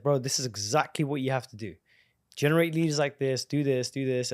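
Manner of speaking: normal talking voice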